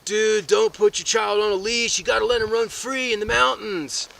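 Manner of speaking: laid-back dude. Retold or imitated. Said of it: "You'll hear some people say".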